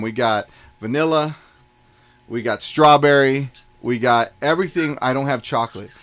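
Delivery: normal talking voice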